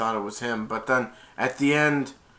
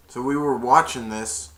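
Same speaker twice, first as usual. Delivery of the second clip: ordinary delivery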